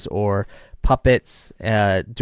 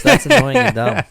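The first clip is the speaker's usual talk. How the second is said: annoyed; 10 semitones higher, 7 dB louder than usual. Real, not acted. Exasperated reaction to censorship news.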